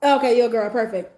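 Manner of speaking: loud, bright fake cheer; loud mock approval. Telling friends her loud fake approval of his look.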